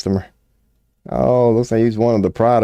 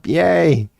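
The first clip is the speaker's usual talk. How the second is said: high, thin mocking cheer; mock rally cheer; 9 semitones higher than usual. Sparse rally crowd cheers for Shapiro, high mocking yay. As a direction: cheer weakly like a dopey crowd. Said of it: "a block of people going".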